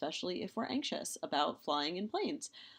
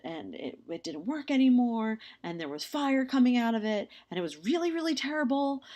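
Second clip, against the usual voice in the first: louder, higher, insistent mock warning; hammed-up friend begging you not to buy it; alarmed warning; 4 semitones higher, 6 dB louder than usual. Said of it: "And he's like".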